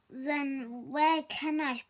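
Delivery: usual speaking voice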